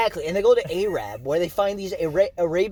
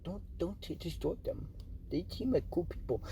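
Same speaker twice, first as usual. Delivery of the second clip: quiet; 13 dB quieter than usual. Retold or imitated. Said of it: "they're like".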